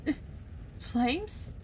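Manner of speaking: normal talking voice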